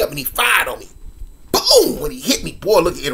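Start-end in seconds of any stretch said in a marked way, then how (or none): 1.5-2.0 sharp high boom effect; sound effect boom. Retold or imitated. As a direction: punch out the boom. Sound effect of being hit.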